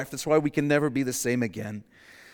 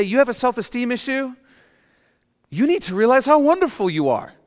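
much higher, louder mock enthusiasm; mock cheerleading; 12 semitones higher, 8 dB louder than usual. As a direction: gush it like a hype coach. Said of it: "They say".

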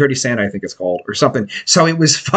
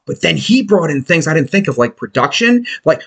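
ordinary talk, nothing marked

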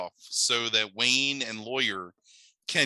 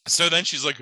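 ordinary delivery

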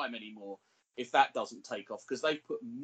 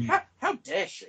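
mock outrage; 9 semitones higher, 6 dB louder than usual. Mock outrage at absent cohost.

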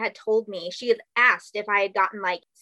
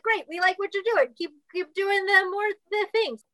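high, chirpy mock studio voice; imitating upbeat producers; 9 semitones higher than usual. Producers praising her Russian accent, mocked high and chirpy. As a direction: play the eager casting people.

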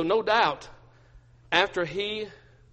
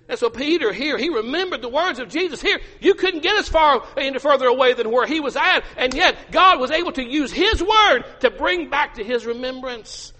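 high, loud, urgent sermon delivery; full-force preaching; 10 semitones higher, 6 dB louder than usual. Acted. Preacher urging parents about their children, full force. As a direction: press it on them with rising fervor.

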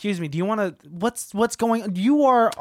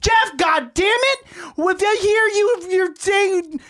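angry shout; 6 semitones higher than usual. Acted. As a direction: yell it at Jeff. Wife furious on phone.

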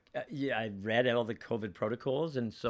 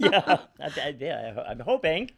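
laughing; 4 semitones higher, 9 dB louder than usual. Real, not acted. Laughs at the host's joke.

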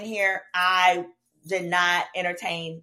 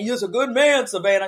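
mock ex voice; 2 semitones higher, 5 dB louder than usual. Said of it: "I done told that man".

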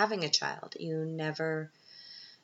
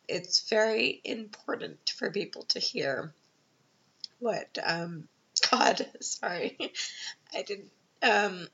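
fighting back tears mid-sentence; crying; 3 semitones higher, slower than usual. Real, not acted. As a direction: voice breaking with tears. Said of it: "I didn't think I would cry".

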